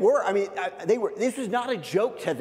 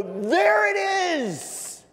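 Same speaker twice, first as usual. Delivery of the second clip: high, slow gleeful announcement; excited reveal; 13 semitones higher, 3 dB louder, much slower than usual. Retold or imitated. Irish hosts proudly show the American pastor a Starbucks, gleeful. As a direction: proudly unveil the big surprise.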